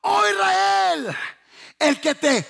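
ordinary talk

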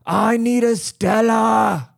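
high, slow British lad shouting; mock British accent. London trash demanding a beer, shouted mock British accent. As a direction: bellow it like a hammed-up British yob. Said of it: "you get around the trash".

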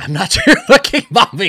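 high, loud mock outburst; laughing mimicry. Mother disowning her kid, high laughing imitation. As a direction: belt it out through a laugh.

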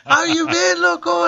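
very high-pitched imitated greeting; Paul Rodriguez. Famous comic greeting the sound guy, high-pitched imitation. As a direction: greet a pal in a playful high impersonation.

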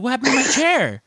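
high-pitched indignant yell at the usher; mock outraged shout over a missing chair; retold yelling. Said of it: "I yelled at poor Melissa".

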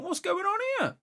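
very high, incredulous retold question; high-pitched mock confusion; mock bewildered protest. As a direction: squeak it up high, acting out disbelief. Retelling being blamed by brother.

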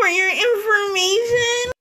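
high, slow, indignant character squeal; Katie. Acted. Character correcting her name to co-hosts, high indignant whine. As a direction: correct them in a whiny put-on voice. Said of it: "Katie's no longer here anymore".

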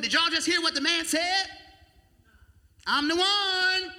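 hammed-up self-announcing voice; mock boast. Preacher mocking a show-off announcing himself. Imagined boastful Jesus quote.

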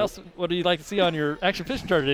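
high, chirpy customer-service voice; bright salesman voice to a client; mock charter host. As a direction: play the overly polite tour guide.

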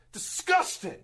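high, loud disgusted shout. Acted. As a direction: spit out the insults at full volume. Wrestler slaps player, insults him.

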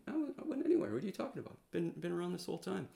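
high-pitched fake innocence; high, innocent denial. Caught servant Gehazi lies to the prophet, high fake-innocent denial. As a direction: play dumb in a squeaky voice.

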